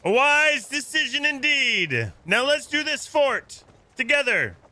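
high, slow, drawn-out proclamation; grand calling out. Pretend. Welcoming new ally to the fort, grand calling out. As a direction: proclaim it to a ship across the water.

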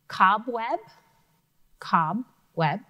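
Cobweb said twice with its two syllables clear; syllable counting. Shown as a demonstration. Teacher counting syllables aloud. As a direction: tap out cob and web in Cobweb. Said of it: "I hear two syllables".

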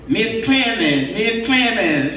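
lightly voiced boss calling her name, drawn out; a hint of the supervisor calling across the office; mimicking mother's supervisor, Mister Perry, calling Miss Clemens; imitating the supervisor. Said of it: "She said that's how he talked".

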